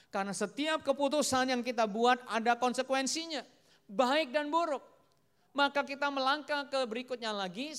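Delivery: loud, high, emphatic sermon voice; forceful preaching. Acted. Preacher repeats the lesson on consequences, forceful preaching. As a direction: hammer the main point home.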